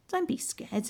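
put-on a young boy comforting his sister; Hansel voice. Hansel voiced, much higher.

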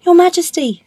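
high, loud cry to the king; alarmed cat. Acted. Puss stopping the passing king, alarmed. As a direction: call out in urgent, high-pitched alarm.

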